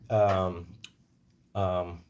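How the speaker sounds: ordinary delivery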